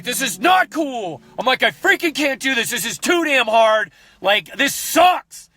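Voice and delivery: high, whiny frustrated complaint; frustrated rant. Retold or imitated. Frustrated rant to wife after losing footage. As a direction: vent like it's all too much. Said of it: "out of rage and frustration".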